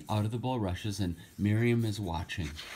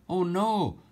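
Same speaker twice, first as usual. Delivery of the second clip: alarmed little cry; 10 semitones higher, 5 dB louder than usual. Acted. Miriam alarmed in story.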